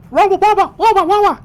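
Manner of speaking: high, loud squeaky creature babble; creature gibberish. Acted. Fish creature babbling to his companions. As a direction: chatter like a tiny frantic fish creature. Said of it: "he goes".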